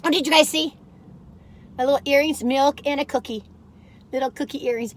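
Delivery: normal talking voice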